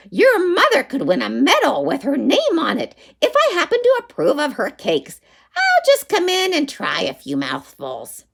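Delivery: high, scheming witch voice. Acted. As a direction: sweeten it like a sly witch.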